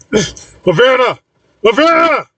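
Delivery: high, drawn-out calls of a name; high-pitched holler, rising on the repeat; shouting. A narrator's reading. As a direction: call out high, stretching the name. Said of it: "Corey shouts".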